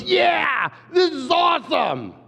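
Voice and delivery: put-on high, loud, delighted kid voice; gleeful child shout. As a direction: shout it like a thrilled four-year-old. Girl gleefully cuts his hair.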